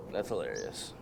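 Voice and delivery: low, deadpan, secretly pissed off; deadpan annoyance. Retold or imitated. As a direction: say it dryly, meaning you hate them. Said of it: "that means I fucking hate you".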